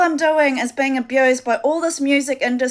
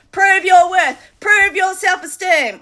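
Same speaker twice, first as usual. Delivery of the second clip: higher, louder taunting demand; mocking the cult; 5 semitones higher, 5 dB louder than usual. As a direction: sneer it like the cult ordering her about. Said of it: "this cult following me".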